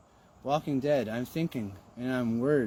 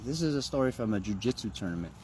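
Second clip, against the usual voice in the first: ordinary delivery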